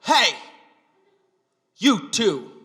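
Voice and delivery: loud, high call from behind; retold yell. Someone yells at the pair walking home, retold yell. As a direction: imitate hailing two people loudly. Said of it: "someone yell".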